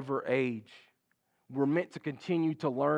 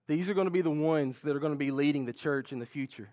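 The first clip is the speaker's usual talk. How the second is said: usual speaking voice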